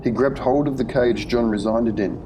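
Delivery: usual speaking voice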